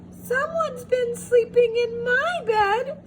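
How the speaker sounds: very high squeaky cub; squeaky Baby Bear. A narrator's reading. Baby Bear finding his bed used, very high squeak. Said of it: "squeaked Baby Bear".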